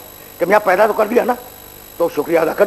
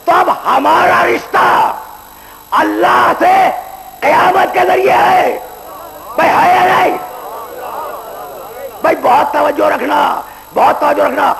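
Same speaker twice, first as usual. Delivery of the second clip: full-force preaching; 7 semitones higher than usual. Acted. Preacher warns the young men about judgement day, in full-force preaching. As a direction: thunder it from the pulpit.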